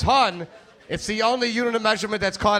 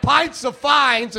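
incredulous; 8 semitones higher, 5 dB louder, much slower than usual. Acted. He answers the cop.

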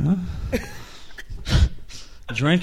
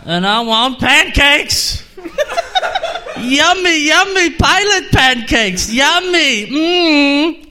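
high, slow, giggly and dreamy; stoned pilot craving; 9 semitones higher, much slower than usual. Acted. Pilot drooling over pancakes, high-pitched and giggling.

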